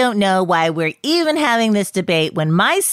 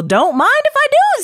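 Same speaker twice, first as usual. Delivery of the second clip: sweet southern voice; 4 dB louder than usual. Acted. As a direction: play it sugary and folksy. [name], high put-on voice.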